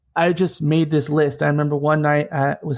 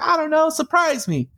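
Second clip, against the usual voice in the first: playful flirt; 12 semitones higher than usual. Retold or imitated. Flirty reply to coffee offer.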